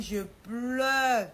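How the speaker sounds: loud, long-held cry; hammed-up wail, stretching the final vowel; mock wailing. Retelling constant weeping after leaving, mock wailing. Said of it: "tout, tout, tout, il dit".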